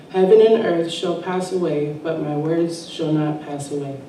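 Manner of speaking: ordinary delivery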